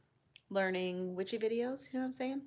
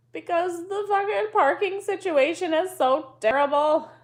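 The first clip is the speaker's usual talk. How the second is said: angry rant; 10 semitones higher, 12 dB louder than usual. Real, not acted. Complaining about school pickup parking.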